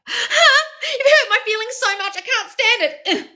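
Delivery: very high, slow hammed-up hurt voice; whiny fake hurt with a laugh; mock wounded whine. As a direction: parody a dramatic victim. Mocking people who block.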